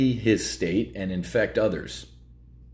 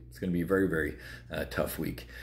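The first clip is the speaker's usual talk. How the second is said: fading to a hush; 3 semitones lower, 6 dB quieter, faster than usual. Real, not acted. Repeats warning, fading.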